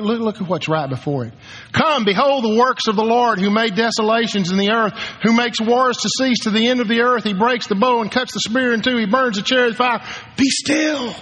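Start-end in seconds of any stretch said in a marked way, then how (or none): 1.7-11.1 full-force preaching. Acted. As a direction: thunder the verses out like a preacher at full cry.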